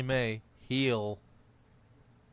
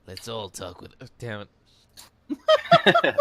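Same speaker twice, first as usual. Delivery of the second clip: gravelly vigilante voice; 11 dB louder, much slower than usual. Acted. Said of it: "swallow a little bit more gravel".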